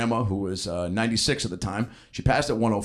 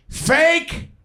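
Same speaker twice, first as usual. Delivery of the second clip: high, loud mock old-lady yell; shrill-free full-volume mock heckle; top of her lungs; 5 dB louder than usual. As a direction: belt it out like grandma in a silent theater. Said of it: "at the top of her lungs goes".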